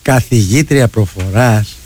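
low, slow drawn-out teasing; teasing mock quote. Radio host retelling a colleague's joke, low slow teasing. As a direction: draw it out low in mock amazement. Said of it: "το παίρνει στο χαβαλέ και του λέει".

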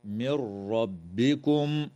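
slow recitation, the ن of من merged straight into ربكم with no nasal hum; idgham without nasal. Shown as a demonstration. Teacher demonstrating idgham without nasalization to students. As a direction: run من into ربكم as مربكم, no humming. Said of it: "تدغم النون الساكنة في الراء من غير غنة".

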